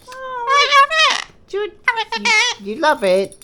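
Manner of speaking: loud, high, drawn-out delight; excited high cooing. Real, not acted. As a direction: gush over the bird, slowly. Excited with pet bird.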